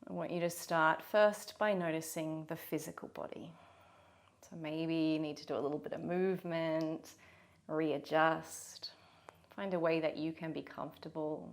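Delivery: unhurried meditation guide with long pauses; guided meditation. Real, not acted. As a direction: gently lead them into the body.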